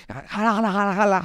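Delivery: high, half-playful imitation of a shouting crowd; mock shouting. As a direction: toss out the calls like people yelling across a market. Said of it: "ellos se gritan".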